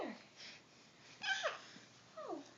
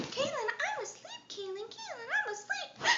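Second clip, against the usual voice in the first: playful high voice; 7 semitones higher, 10 dB quieter than usual. Pretend. Pretending to sleep for baby.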